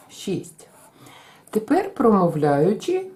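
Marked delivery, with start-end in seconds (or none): 0.1-0.7 whisper. Real, not acted.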